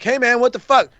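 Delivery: normal talking voice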